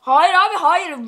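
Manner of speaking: shouting no in protest; loud protest. Real, not acted. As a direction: insist loudly, refusing. (bağırarak itiraz)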